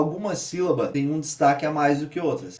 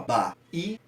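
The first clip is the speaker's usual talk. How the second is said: "Bahia" in pieces, the middle I standing out; ba, I, a with pauses between; stressed syllable demo. Shown as a demonstration. Teacher repeating to find the tonic, split with stressed I. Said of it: "ascendência na segunda sílaba".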